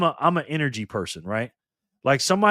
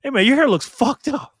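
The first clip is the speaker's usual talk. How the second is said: high, louder, rude voice of a stranger insulting your hair; mocking put-down in a higher, louder voice; 10 semitones higher, 6 dB louder than usual. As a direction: play a jerk tossing off an insult. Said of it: "another person says".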